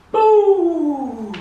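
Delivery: very high loud boo; playful boo. Real, not acted. Revealing the lit tree to viewers, a high loud boo.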